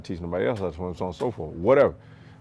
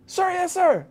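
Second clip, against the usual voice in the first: recruit answering; 3 dB louder, slower than usual. Retold or imitated. Recruit answering drill instructor.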